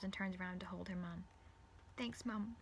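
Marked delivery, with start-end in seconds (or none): 2.0-2.6 muffled against cloth. Acted. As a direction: speak into a dress. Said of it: "muffled in her mom's dress".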